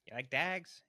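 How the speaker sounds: put-on Brad Pitt's Pikey accent, "dags" for dogs; mock Irish Traveller drawl on "dags".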